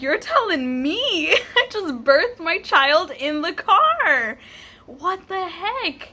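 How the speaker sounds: high, slow, laughing amazement; retold disbelief. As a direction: draw it out, incredulous and half laughing. Amazed reply to nurses retold.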